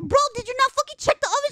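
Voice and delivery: high, loud, fast reenacted telling-off; exasperated scolding. Sibling to sister about the oven, exasperated scolding. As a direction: scold in rapid, high-pitched disbelief.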